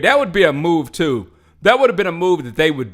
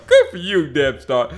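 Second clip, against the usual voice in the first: ordinary talk, nothing marked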